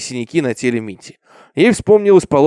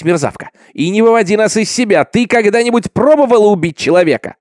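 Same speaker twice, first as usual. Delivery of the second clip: raised, higher bullying voice; angry interrogation; 7 semitones higher, 5 dB louder than usual. A narrator's reading. Villager hurries the girl to confess, loud and angry. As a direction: demand it loudly, out of patience.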